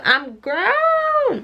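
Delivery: high-pitched playful brag; sassy squeal. Acted. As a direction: squeal it out proudly. Sassy girl-talk aside to viewers.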